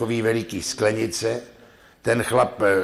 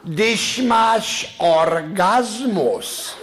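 very slow, high, laughing question; gossiping woman; 9 semitones higher, 4 dB louder, much slower than usual. Acted. Woman asks her friend a cheeky question, high and slow. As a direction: drag out the naughty question.

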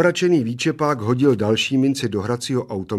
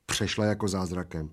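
plain reading voice

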